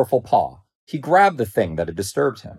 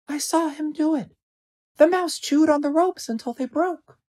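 plain-spoken little bird. A narrator's reading. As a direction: report it simply as a small bird. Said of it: "the bird said plainly".

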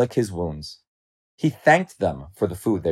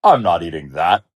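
low, firm refusal; disdainful lion; 4 semitones lower, 4 dB louder than usual. A narrator's reading. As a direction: turn the food down haughtily. Lion rejects the food brought.

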